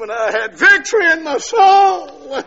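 high, loud, tearful shout; preacher crying out his joy at being saved; joyful testimony shout. Acted. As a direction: shout the victory out.

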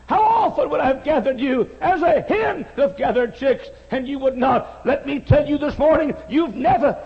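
high, loud, slow pleading calls; preaching cry. Acted. Preacher calling sinners as Jesus over Jerusalem, loud lament. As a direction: plead with each sinner at the top of the voice.

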